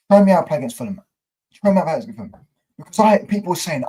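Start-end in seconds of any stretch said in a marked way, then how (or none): 0.1-2.3 emphatic rant. Real, not acted. Ranting challenge to the team.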